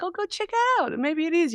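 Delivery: high-pitched, airy mock reply; imitated eager advice. Others answering her about the house for sale, imitated high and eager. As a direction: pitch it way up, urging someone on.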